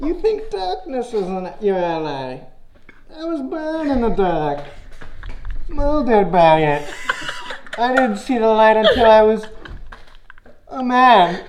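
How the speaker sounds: slow, menacing movie villain growl through a mask; Bane impression. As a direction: drag each line out like a masked villain threatening Batman. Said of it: "That's the Bane impression".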